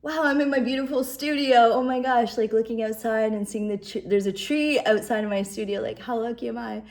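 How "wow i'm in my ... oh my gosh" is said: rediscover the room with delight; a happy gasp of amazement; delighted wonder. Retold or imitated. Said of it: "and then suddenly I saw".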